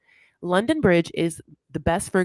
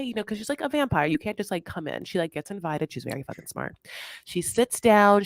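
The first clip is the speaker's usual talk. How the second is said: usual speaking voice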